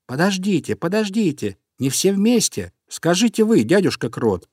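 storybook hare appealing for calm; calling for order. A narrator's reading. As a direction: hush an eager crowd without shouting. Hare calls the noisy crowd to order.

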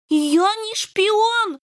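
protest, hurt and indignant; indignant kitten. A narrator's reading. Kitten protests against the general, indignant and high. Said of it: "возмутился Рыжий".

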